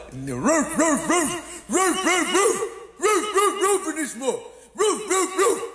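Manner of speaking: very high yapping woof woof; barking like a dog. Pretend. Barking at those who play with the dog. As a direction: bark high like a little dog.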